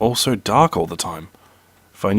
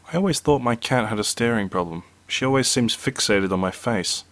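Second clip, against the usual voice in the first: plain reading voice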